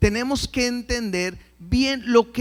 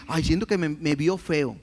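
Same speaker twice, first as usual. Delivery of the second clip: mock fearful believer; 6 semitones lower than usual. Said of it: "¿Por qué van a decir".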